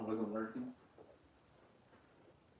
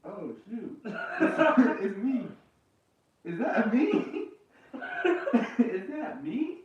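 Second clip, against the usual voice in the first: laughing in disbelief, pitched up; 10 semitones higher, 5 dB louder, much slower than usual. Real, not acted. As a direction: giggle through it, surprised. Sees himself on stream.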